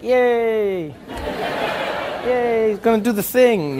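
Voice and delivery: mock cheer. Mock cheering at police horse.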